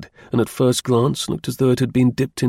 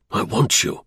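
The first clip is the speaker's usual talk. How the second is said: low, contained call with urgency; low-pitched urgency; 3 semitones lower than usual. A narrator's reading. Calling his companion from the hall, low and urgent. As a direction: keep the voice down but make it carry. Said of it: "decidedly low-pitched, he somehow contrived to fling into it a note of urgency".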